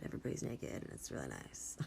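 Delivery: soft breathy whisper aside. Real, not acted. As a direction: drop to a hushed whisper. Playful aside trailing into whisper.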